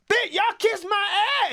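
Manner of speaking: put-on high, shouted kid voice; loudest kid. Loudest kid on the bus shouting at everyone, high. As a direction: bellow it at the whole bus. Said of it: "He was the loudest one".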